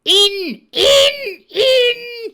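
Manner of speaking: very high, very loud furious shout. Acted. Blind beggar driving his men inside, raging shout. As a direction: yell in a blaze of eagerness and rage. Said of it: "he shouted".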